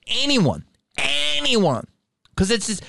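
heated, high-pitched challenge; getting heated. Real, not acted. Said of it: "I'm getting heated again".

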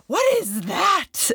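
high, slow, stretched-out alarmed question; alarmed child. Retold or imitated. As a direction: pull it out like a startled kid. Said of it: "Riken's like".

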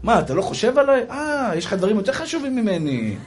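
hammed-up wounded suspicion; suspicious wife. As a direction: play an accusing, put-upon wife. Acted suspicious wife on phone.

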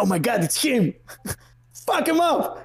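high-pitched mock battle cry of a kid mob; mock excited kids. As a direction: play kids calling the gang to attack, voice jumping up. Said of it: "και λένε". (παιδική ιαχή επίθεσης, στα αστεία)

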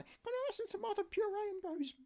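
high falsetto shop request; niece asking in shop. Retold or imitated. Niece asking the shopkeeper, high falsetto. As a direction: voice her asking politely, pitched high. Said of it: "you say".

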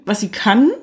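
usual speaking voice

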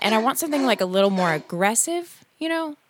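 usual speaking voice

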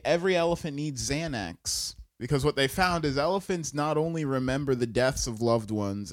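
usual speaking voice

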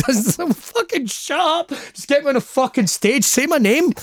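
high, strained mock pleading; exasperated inner-voice whine; mock impatience. Impatient reaction to long introduction.

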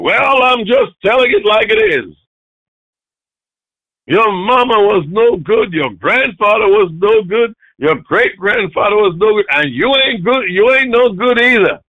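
high, loud acted tirade; imitating an angry scolding at full volume; frustrated, emotional rant. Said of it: "They become emotional".

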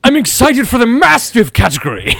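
high, bright-faced fancy spectator; eager posh spectator. Acted. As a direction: gush like a fancy dog show guest.

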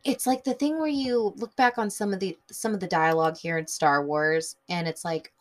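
ordinary talk

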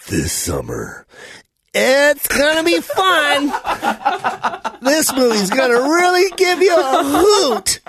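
hammed-up masculine trailer parody; mocking trailer voice. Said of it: "he is so masculine with that".

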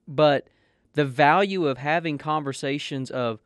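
ordinary delivery

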